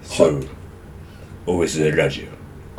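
deep growling Otomo impression; [name] voice. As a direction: drop way down into a husky singer impression. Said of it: "そんな渋い声でしたっけ？".

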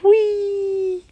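high, breathy whee of a slide ride; going down a slide. Acted. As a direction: squeal down the slide.

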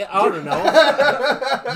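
slow stretched laughing words; drawn-out laughing. Real, not acted. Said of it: "drawn-out laughing shrug".

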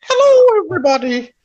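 very high, piercing warbly cooking-host greeting; Julia Childs impression. Co-host greets viewers as a TV cook, high-pitched impression. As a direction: trill it out sky-high like Julia. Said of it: "You got, you got Julia Childs".

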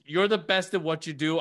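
ordinary delivery

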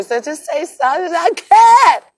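high choked little voice; a girl too upset to speak; voicing Lil Mama. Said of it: "Lil mama couldn't even say nothing".

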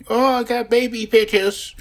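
high put-on voice of a future parent; mock proud parent. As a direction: squeak it like a doting parent showing old photos.

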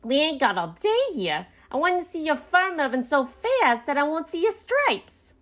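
high bossy character voice; yelling. A narrator's reading. Beasley rushes the raccoons unloading, yelling. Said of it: "he yelled".

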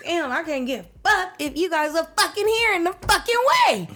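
high, loud, frustrated rant building to a yell; exasperated mock outburst. Mom venting about kids at home, loud mock rant. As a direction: let it all out, half laughing, building to a shriek.

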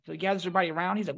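ordinary delivery

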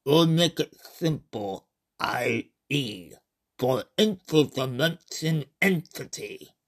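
slow, calm otherworldly alien voice; alien being. Acted. Alien Kafu introducing itself to the human, slow and calm. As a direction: speak as a patient visitor from another dimension.

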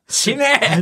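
sudden retold yell; mock huge shout. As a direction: burst out with one drawn-out comic shout. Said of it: "俺がでかい声出しすぎて".

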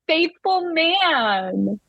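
slow, lingering dreamy sigh; dreamy swoon. Real, not acted. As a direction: melt over the words. Swooning over Noah.

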